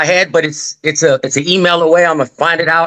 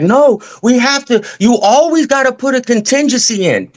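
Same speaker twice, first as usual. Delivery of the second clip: imitated agent insisting, pitched well up; mock-insistent correction in a much higher voice; insistent objection; 9 semitones higher than usual. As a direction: push back as the know-it-all agent, voice raised high. Acted insistent agent in retold dispute.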